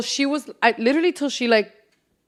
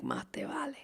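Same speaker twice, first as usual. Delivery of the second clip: whisper; 19 dB quieter than usual. Shown as a demonstration. Whispered phrase.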